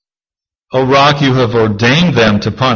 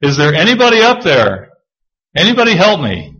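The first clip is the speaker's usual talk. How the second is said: high, pleading call for help; stranded man calling up; 6 semitones higher than usual. Retold or imitated. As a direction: call upward for rescue, lightly acted. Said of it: "he just looks up and he says".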